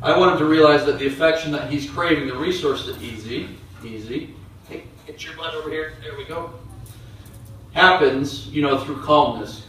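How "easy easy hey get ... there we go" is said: much softer, soothing the dog; hushed calming voice to an excited dog; soft calming murmur. Real, not acted. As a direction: ease him down under your breath.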